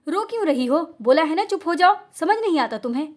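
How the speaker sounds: barking at her to stop crying; angry roar. Acted. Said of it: "गुस्से से धाड़ता है". (गुस्से में दहाड़ती आवाज़)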